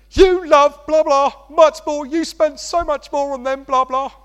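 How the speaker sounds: high, loud mock whine of a jealous kid; whiny child voice. Child complaining about siblings.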